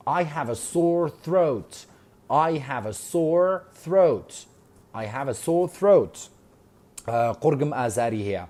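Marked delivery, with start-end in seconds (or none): 0.1-6.3 I have a sore throat drawn out very slowly; slow model line. Shown as a demonstration. Teacher drilling Lily's line slowly for students. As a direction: say it at half speed for repeating. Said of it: "slow model reading".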